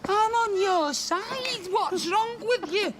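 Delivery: high drawn-out mock-hurt protest; silly high voice. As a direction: whine it out high and slow. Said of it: "and I went".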